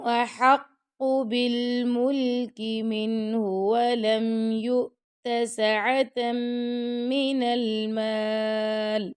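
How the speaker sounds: slow, measured tajweed chant; Quran recitation. Shown as a demonstration. Teacher reciting the verse to students, slow and melodic.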